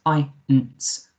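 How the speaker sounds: "eins" drawn out slowly with an "eye" vowel; "eins" slow, vowel first, then "nts"; long I sound. Shown as a demonstration. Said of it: "make that I sound".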